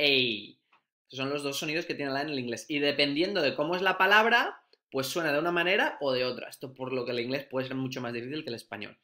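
usual speaking voice

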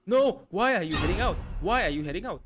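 loud, high cry of protest; frustrated outburst; exasperated yell. Real, not acted. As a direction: yell in dismay at the game. Yelling at character during game.

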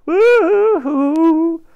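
loud, high, drawn-out boo-hoo wail; mock sobbing in one long wail; hammed-up bawling. Boy bursts into floods of tears, hammed-up bawling. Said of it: "burst into floods of tears".

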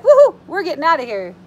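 loud, quick whoop of freedom; gleeful escape cheer. Acted. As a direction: cheer as the little letters break free. Letters wiggle free, celebrating escape.

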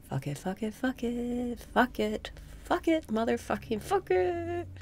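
climbing into a high, small comic squeal; letting go. Shown as a demonstration. Breathing coach modelling how to say fuck it, comically squeaky. Comic escalating release mantra.

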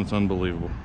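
ordinary talk

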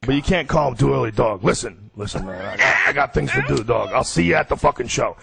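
a hint of a brash comic cutting someone short; Joey Diaz. Impersonating the friend putting off an early call, lightly impatient. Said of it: "Diaz complains about shit".

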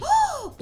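high, sudden gasp; quick high intake of breath; excited gasp. Real, not acted. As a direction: gasp at a surprise. Phone call arrives in game.

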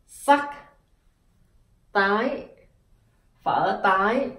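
tái said with a sharp rising tone; sắc tone. Shown as a demonstration. Teacher demonstrating tái to students. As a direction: lift the pitch on tái, in Vietnamese. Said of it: "you add this is dấu".

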